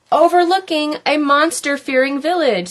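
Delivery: plain reading voice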